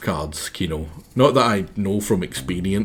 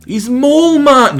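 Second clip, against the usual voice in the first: much higher, louder announcer-ish cartoon line; high character voice; 9 dB louder than usual. Naming Mason Mole, high and proud. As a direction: belt it out squeaky and proud. Voicing game character dialogue.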